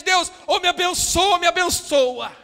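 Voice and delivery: high, loud ultimatum to God; cornering God. Retold or imitated. Believer putting God against the wall, as a loud ultimatum. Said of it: "coloca como se colocasse Deus na parede".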